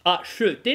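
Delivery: "acheter" loud, with the middle э sounded; articulated schwa. Shown as a demonstration. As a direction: voice the э in "acheter", a-sheu-tay. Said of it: "эту «э» нужно уметь проговорить".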